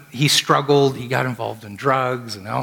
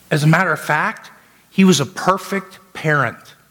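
preaching at full force; 6 semitones higher than usual. Acted. Pastor preaching on air to a grieving caller. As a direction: drive the sermon up to its peak.

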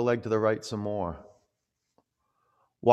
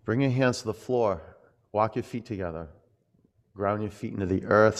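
ordinary talk, nothing marked

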